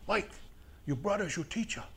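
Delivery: put-on a light German accent, firm and insistent; lightly put-on German accent, telling him off; a soft German accent, stern but quiet. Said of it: "German accent".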